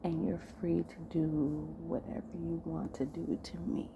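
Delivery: hushed, intimate delivery with long pauses; seductive role-play. Acted. Man giving his partner intimate orders, hushed seduction.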